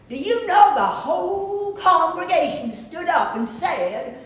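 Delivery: high, loud, hammed-up Southern drawl; terrible Southern accent. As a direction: play a Southern churchgoer in a badly faked accent. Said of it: "in my terrible accent".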